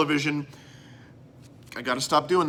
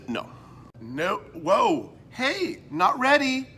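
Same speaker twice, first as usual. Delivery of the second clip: ordinary talk, nothing marked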